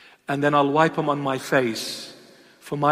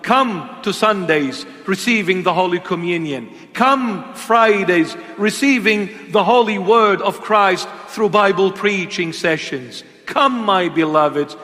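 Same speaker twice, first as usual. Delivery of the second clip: loud, slow preacher's call to come; preaching invitation; 4 semitones higher, 5 dB louder than usual. Acted. Preacher calling his beloveds to church, loud and drawn out.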